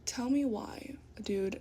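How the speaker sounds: unvoiced aside whisper; whispered disbelief. Real, not acted. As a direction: breathe it out like a secret. Repeated line dropped to whisper.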